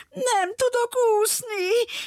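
put-on high, slow whimpering little girl. Little girl on the lion's back whimpers she cannot swim, high and tearful. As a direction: whimper it like a frightened child. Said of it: "pityergett a kislány".

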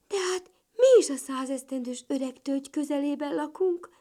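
high, loud delighted ladybird; joyful. A narrator's reading. Little ladybird realising they are neighbours, joyful. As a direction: exclaim it with rising delight. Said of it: "örvendezett kis Katica".

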